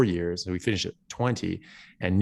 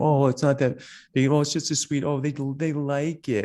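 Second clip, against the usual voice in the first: high, slowing, dismissive mock voice of relatives; imitating a relative excusing a sweet with a higher put-on voice; 7 semitones higher than usual. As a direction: parody someone brushing it off.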